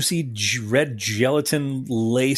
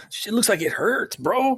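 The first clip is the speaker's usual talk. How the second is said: higher, hammed-up Southern yokel voice; mock Southern accent; 10 semitones higher than usual. Riffing along in Southern accent.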